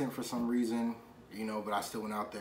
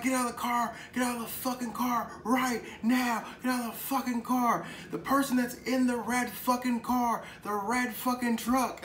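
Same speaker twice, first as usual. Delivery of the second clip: high-pitched, raised imitation of a shouting cop; police yelling; 11 semitones higher, 3 dB louder than usual. Police yelling at the red truck's passengers, mock shouting. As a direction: yell the orders like a cop on a raid. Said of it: "they're yelling and screaming".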